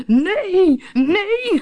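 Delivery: loud, very high yells of refusal; screaming. A narrator's reading. Captain flees out of the window, screaming. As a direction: scream it in horror. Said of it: "gilde hij".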